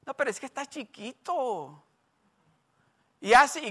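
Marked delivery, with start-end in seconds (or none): 0.0-1.8 indulgent parent. Retold or imitated. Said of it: "Muchos papás dicen".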